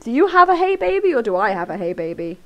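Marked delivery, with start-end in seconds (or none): 0.6-1.1 mock caller voice. Streamer acting the mystery caller's hey baby, higher and louder. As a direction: play the caller high and loud. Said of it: "Is that me or you?".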